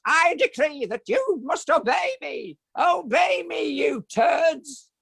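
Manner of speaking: very high, slow, pompous royal decree; squeaky tyrant king barking orders; shrill puppet king, shouting the last words; Gonk King voice. Shown as a demonstration. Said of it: "the Gonk King would be appalled".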